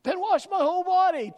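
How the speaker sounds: high, loud, over-eager outburst of Peter. Retold or imitated. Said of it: "typical Peter".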